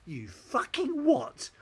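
high, loud, drawn-out disbelief; hammed-up incredulous retort; mock outrage. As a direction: demand it slowly in comic indignation. Acted outrage at being called Australian.

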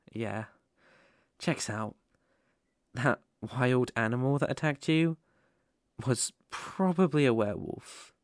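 usual speaking voice

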